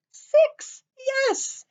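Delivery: excited triumph. Real, not acted. Teacher counting legs with children, excited high cheer. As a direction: celebrate getting to six.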